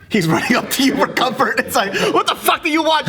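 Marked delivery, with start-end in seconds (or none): 2.1-3.1 high-pitched mock exasperation; mock anger. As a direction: imitate being fed up with a crying kid. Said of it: "he'll be mad at".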